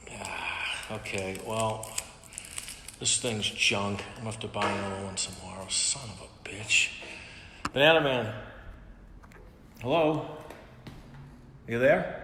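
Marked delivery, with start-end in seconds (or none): none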